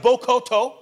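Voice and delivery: loud burst of tongues; strong tongues prayer. Retold or imitated. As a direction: punch out the syllables like fervent prayer. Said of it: "but it was strong".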